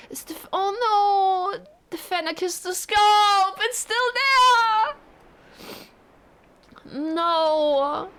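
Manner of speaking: high, loud, slow wailing; anguished wail. Real, not acted. Gamer wails at the toy left on the desk, high and loud. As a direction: wail at the abandoned gift. Finds the gift left behind.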